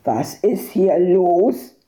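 slow, stern challenge; gruff dog owner. A narrator's reading. Yard owner with rifle challenges the noise, sternly. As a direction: demand an answer from an intruder.

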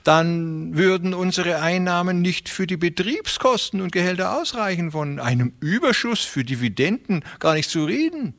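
high, friendly, persuasive voice; smooth fat railway man. A narrator's reading. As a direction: talk them round with oily politeness. Beermann voice again.

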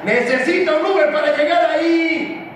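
high-pitched sarcastic complaint; exasperated yell. Acted. Coach about a player missing the ball, exasperated yell.

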